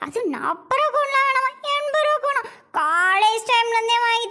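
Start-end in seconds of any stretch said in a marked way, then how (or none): 0.1-2.4 high-pitched aggrieved complaint; indignant grumbling. Acted. Wife watching husband buy another child cones, indignant. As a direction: carp jealously about the favouritism.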